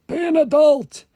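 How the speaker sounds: high mock scolding voice; squeaky high voice. Shown as a demonstration. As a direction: squeak it like a cartoon nag. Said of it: "repeated in put-on voice".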